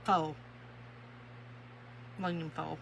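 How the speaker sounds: "foil" said as "foal", one long o; accented "foil" as foal. Shown as a demonstration. Wife showing how she says foil, in her accent. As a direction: drop the i from "foil": "fole". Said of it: "the way I say".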